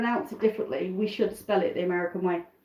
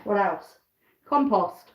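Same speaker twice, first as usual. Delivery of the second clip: ordinary talk, nothing marked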